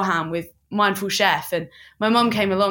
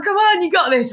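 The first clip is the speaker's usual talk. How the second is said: encouraging; 10 semitones higher, 5 dB louder than usual. Retold or imitated. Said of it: "And I was like".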